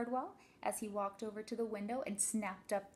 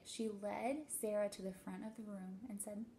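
plain reading voice

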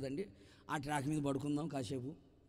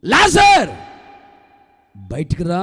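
commanding shout; 21 dB louder, much slower than usual. Acted. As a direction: command the dead man to come out. Jesus calls Lazarus from tomb. (సమాధిలో ఉన్న లాజరును గట్టిగా పిలవడం)

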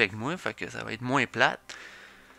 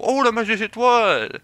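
high, loud burst of delight; pitched-up excited character voice; excited exclamation; 11 semitones higher, 9 dB louder than usual. Acted. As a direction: exclaim at a thrilling discovery. Eric spots the all-star game.

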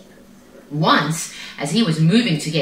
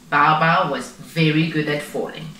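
ordinary delivery

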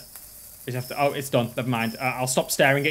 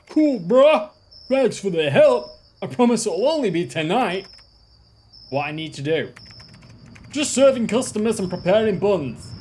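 higher, put-on coworker voice; boss character voice; 3 semitones higher than usual. Boss to new worker, high put-on voice. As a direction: play the laid-back boss handing over the shift.

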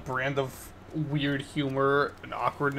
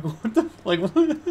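rising disbelief, ending in a high squeak; baffled; 8 semitones higher, 5 dB louder, slower than usual. Retold or imitated. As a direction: go from confused to a shocked high what. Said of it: "I'm like".

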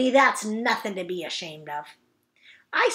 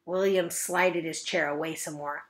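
usual speaking voice

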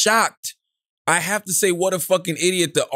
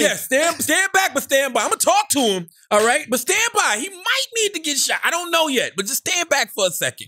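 high-pitched, hammed-up standoff talk; mock restraining a homeboy; 7 semitones higher than usual. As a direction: play at holding a hothead back, voice pitched up. Acts out restraining an armed friend.

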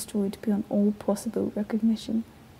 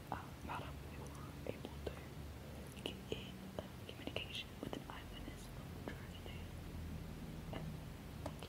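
very soft, far off the microphone; barely audible, halting studio murmur; hushed aside; 23 dB quieter than usual. Acted. Announcer aside about an eyewitness link, hushed and halting.

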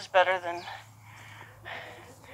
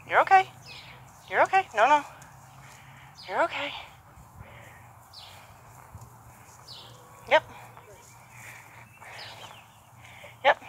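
high soothing reassurance; 7 semitones higher, 3 dB quieter than usual. Real, not acted. Soothing a nervous horse.

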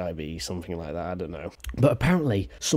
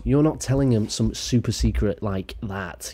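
normal talking voice

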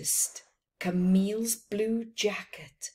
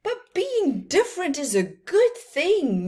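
insisting; 9 semitones higher, 7 dB louder than usual. Acted. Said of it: "Mimi insisted".

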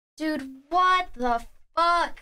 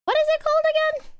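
much higher, fast, cartoonish squeak; squeaky silly voice; faster than usual. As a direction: ask it in a tiny goofy voice. Sudden squeaky funny voice.

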